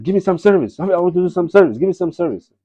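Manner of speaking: high, pleading, keen servant voice; high eager pleading voice; eager Hanuman. Retold or imitated. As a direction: beg for work like an eager servant. Hanuman begs Ram for service.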